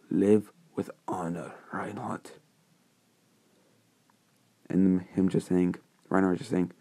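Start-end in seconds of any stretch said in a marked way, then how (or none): 0.1-2.4 soft, breathy farewell; hushed parting words. Acted. Dying king bids Reinhardt farewell, hushed. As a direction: fade away on the name.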